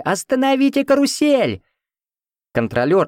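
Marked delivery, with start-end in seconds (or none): 0.0-1.6 high, drawn urgent shout; women's cry. A narrator's reading. Women at the carousel to its operator, high shout. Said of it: "Женщины кричали".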